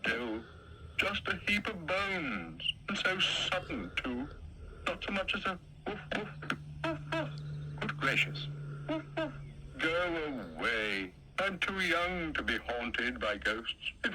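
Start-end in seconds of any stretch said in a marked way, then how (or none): 4.9-9.3 high mock woof. Imitating the dog's bark.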